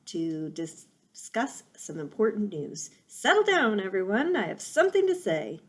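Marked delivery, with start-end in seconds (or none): 3.1-5.6 loud, higher owl calling the crowd to order; hooting owl. Acted. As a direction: hoot for silence over the crowd. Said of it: "Swooper the owl hooted".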